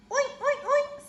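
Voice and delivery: very high, short squealed oinks; pig oinks. A narrator's reading. Pig answering from under the mask, high squealy oinking. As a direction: squeal three quick oinks. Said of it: "says the pig".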